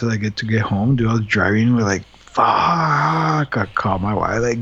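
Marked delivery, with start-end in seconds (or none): 2.2-3.6 groaned curse. Retold or imitated. Driving home feeling sick.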